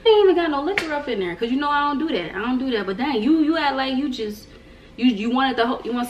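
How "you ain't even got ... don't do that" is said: playful scolding. Pretend. Scolding viewer as greedy friend.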